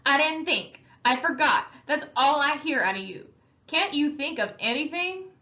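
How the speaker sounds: loud and high angry tirade; angry shout. Acted. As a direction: hammer him with every excuse. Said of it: "Derry shouted".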